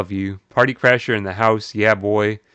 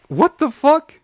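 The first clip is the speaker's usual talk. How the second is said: much higher, loud; shocked exclamation; 5 dB louder, slower than usual. Real, not acted. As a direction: blurt it out in shock. Shocked reaction.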